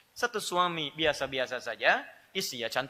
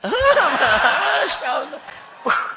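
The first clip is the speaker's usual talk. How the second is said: slow, loud laughing cry; laughing exclamation; 12 semitones higher, 9 dB louder, much slower than usual. Real, not acted. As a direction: burst out laughing as you praise God. Preacher laughing at his story.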